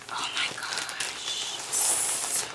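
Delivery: real awestruck whisper; awed whisper. Real, not acted. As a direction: breathe "oh my gosh" out in stunned awe. Awed at a generous gift.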